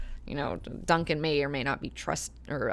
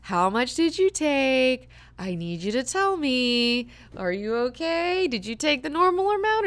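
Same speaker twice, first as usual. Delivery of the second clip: put-on higher, slow and gentle, as to a child; like asking a kid; 7 semitones higher, 5 dB louder than usual. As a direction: coax it out of her like a child. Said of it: "asking her like a kid".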